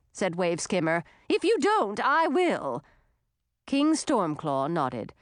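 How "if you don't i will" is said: high-pitched, insistent female dragon; pushy, high character voice. A narrator's reading. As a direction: press the demand, pitch raised. Waveskimmer threatens to tell herself.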